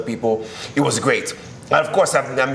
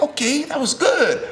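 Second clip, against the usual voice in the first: higher and slow, admiring; impressed praise; slower than usual. Retold or imitated. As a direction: savour the praise, drawn out. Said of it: "we were like".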